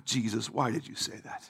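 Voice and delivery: very low, soft aside; muttered mock complaint. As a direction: grumble it under your breath to Jesus.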